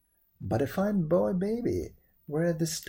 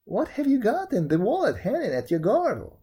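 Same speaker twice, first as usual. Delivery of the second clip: high, loud storybook monkey; 7 semitones higher, 6 dB louder than usual. A narrator's reading. Monkey asking what is in the wallet, high loud voice. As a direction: pipe up loudly as the monkey.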